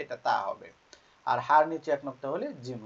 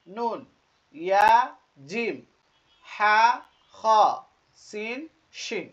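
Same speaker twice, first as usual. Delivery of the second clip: each letter name higher and drawn out; letter-by-letter recitation; 6 semitones higher, 9 dB louder, much slower than usual. Shown as a demonstration. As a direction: recite slowly for learners to repeat, pausing between letters. Reads letters for learners. (টেনে টেনে হরফ পড়া)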